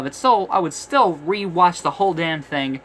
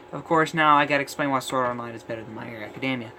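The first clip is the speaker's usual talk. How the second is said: ordinary delivery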